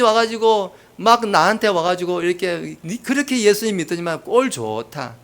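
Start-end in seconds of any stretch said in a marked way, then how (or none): 2.8-5.1 imitated sneering congratulations; acted scoffing; sarcastic mock jeer. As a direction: needle the sufferer with fake admiration. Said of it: "이렇게 비아냥댄다는 거예요".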